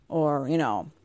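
usual speaking voice

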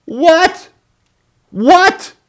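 very high squeaky what; shrieked what. Retold or imitated. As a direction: yelp it in horror. Shocked at slide show.

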